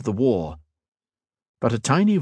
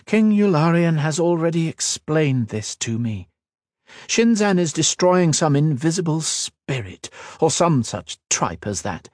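a hint of a proud queen, raised a little; regal queen, lightly voiced; 8 semitones higher than usual. Queen questioning the mage, lightly voiced regal. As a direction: speak as a wary, commanding queen.

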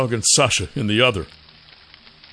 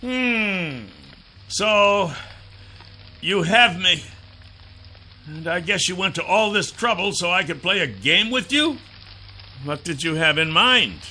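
higher, wry, cocky hero voice; cocky dragon hero; 6 semitones higher than usual. Acted. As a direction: tease the captor lightly. Dragon mocks captor.

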